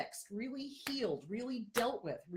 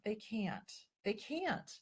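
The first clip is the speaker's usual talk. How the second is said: ordinary delivery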